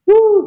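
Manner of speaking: high, loud joyful whoop; a sudden overjoyed whoop to close the reading; excited whoop. Real, not acted. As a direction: let out a big happy woo. Bursts out after emotional reading.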